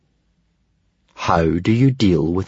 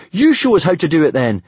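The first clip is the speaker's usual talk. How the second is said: higher-pitched eager plea; relieved cry; 6 semitones higher than usual. A narrator's reading. Hiccup asks the dragon for help, relieved. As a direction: ask it high and relieved. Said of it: "cried Hiccup in relief".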